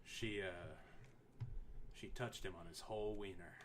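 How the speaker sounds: low, soft, mock-sultry murmur; a hint of a seductive narrator; sexy voice. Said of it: "let me get in my sexy voice here".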